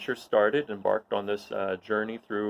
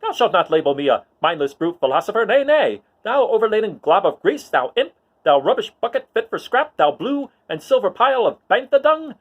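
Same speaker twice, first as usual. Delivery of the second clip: high, loud, prissy droid scolding; high-pitched indignant protocol droid arguing; fussy C-3PO; 12 semitones higher, 8 dB louder than usual. Acted. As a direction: scold the little droid like a huffy butler. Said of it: "he's arguing with C three P O".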